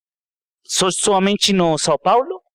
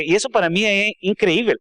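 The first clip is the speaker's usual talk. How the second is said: ordinary delivery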